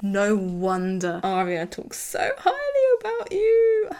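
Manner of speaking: hammed-up fawning praise; gushing flattery. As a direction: lay the flattery on thick, slowly. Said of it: "blows more smoke up his asshole".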